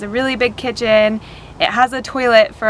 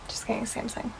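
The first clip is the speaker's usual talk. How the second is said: whispered aside; 16 dB quieter, faster than usual. Real, not acted. Aside correcting TV brand.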